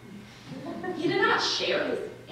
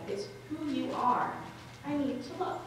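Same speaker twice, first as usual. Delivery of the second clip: soft, gentle lover's voice; hushed, earnest, a little higher; tender insistence; 4 semitones higher, 7 dB quieter than usual. Acted. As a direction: reassure her softly but hold firm.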